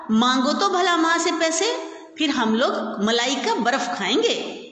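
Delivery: put-on a six-year-old wheedling his brother; lightly voiced youngest child; little boy. A narrator's reading. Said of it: "बोला".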